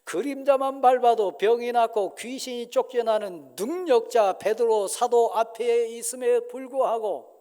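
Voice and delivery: loud, driving sermon delivery; fervent preaching. Acted. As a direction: preach it with full conviction. Preaching at full force. (힘찬 설교조)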